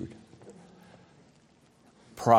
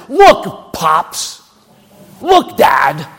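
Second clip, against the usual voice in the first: loud, scornful "Look, Pops"; loud mock of a resentful son; disdainful son; 9 dB louder, much slower than usual. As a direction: bark it at the father like a resentful son. Preacher voicing scornful elder brother.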